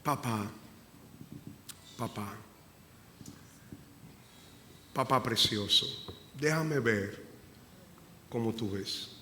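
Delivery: soft pleading prayer to papá; hushed, intimate prayer; tender prayer. Real, not acted. Pastor prays to God.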